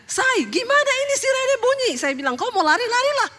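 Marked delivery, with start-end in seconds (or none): 0.1-1.9 high, urgent imitation of a shouting husband; retold panicked yell. Husband calls to wife in the bathroom as the siren sounds, retold yell. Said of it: "Suami saya teriak". 2.4-3.3 high-pitched, dismissive retold comeback; exasperated retort. Wife answers husband shouting about the siren, exasperated retold retort.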